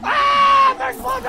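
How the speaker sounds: imitated high scream; mock scream. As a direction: shriek it like the monster. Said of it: "I heard him say".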